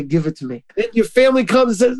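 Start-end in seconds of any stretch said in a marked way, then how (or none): 0.0-0.6 soft, low voice of God; quiet request. Acted. God asking the hurt to hand it over, softly. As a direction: lower and gentler, an understated divine request.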